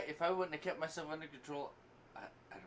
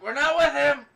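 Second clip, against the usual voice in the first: very loud, high denial; mock shout; 10 semitones higher, 15 dB louder than usual. Imitating Deadpool's shout.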